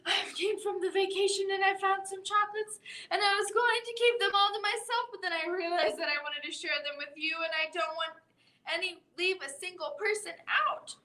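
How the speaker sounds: lightly acted breathless rush; out of breath. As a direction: gabble it out of breath from running. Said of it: "a very out of breath and a bit short voice".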